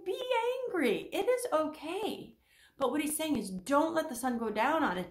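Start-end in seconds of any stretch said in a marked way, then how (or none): 0.0-2.3 voicing God. Voicing God's permission.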